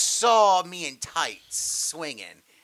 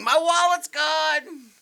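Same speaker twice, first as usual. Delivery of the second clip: high, loud, stretched-out mock cry; hammed-up slow, high scream; 12 semitones higher, 5 dB louder, much slower than usual. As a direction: holler it in exaggerated panic. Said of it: "because I'm screaming".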